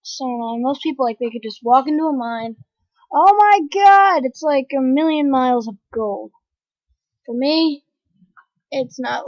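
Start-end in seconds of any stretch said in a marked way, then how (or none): 3.1-4.2 slow, high, loud gasp of wonder; excited oh my god. Real, not acted. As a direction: marvel at the gold, louder and slower. Gamer excited at gold mine.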